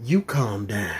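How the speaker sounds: slow, soft, low reassurance; calm and cool. Retold or imitated. Breaking up a bus fight, slow soft calming words. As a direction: settle them down like a cool uncle. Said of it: "all I did was I was like".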